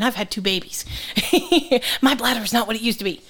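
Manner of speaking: normal talking voice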